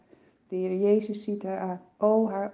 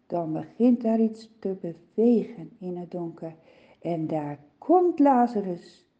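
ordinary delivery

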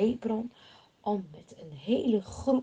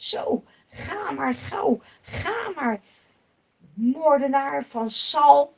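higher, louder, slow jeering curse; cursing; 5 semitones higher, 8 dB louder, slower than usual. Acted. Shimei cursing the fleeing King David, loud and jeering. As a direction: spit out a mocking insult. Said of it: "Als hij roept ... scheldt hem uit".

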